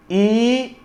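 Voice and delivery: very slow, letter by letter; spelling out letters. Shown as a demonstration. Teacher spells the word slowly for the class. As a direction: spell E A G L one letter at a time. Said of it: "eagle".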